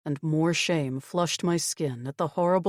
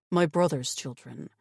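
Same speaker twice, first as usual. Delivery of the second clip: ordinary delivery, nothing marked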